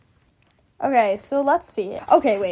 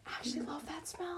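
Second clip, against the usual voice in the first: whispering; 22 dB quieter than usual. Real, not acted. Confessing a liked smell.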